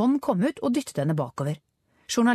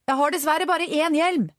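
high, sustained calling voice; shouting over engine; 10 semitones higher than usual. A narrator's reading. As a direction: make it carry over the scooter. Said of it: "ropte hun over putringen fra scooteren".

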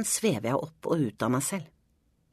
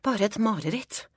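higher, firmer second try at convincing oneself; raised, insistent self-talk; insistent self-reassurance; 7 semitones higher than usual. A narrator's reading. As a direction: say it to yourself, pitched up. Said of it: "sier jeg til meg selv".

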